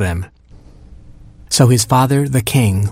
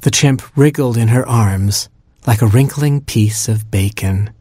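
ordinary delivery

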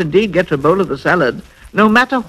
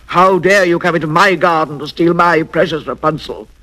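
ordinary delivery

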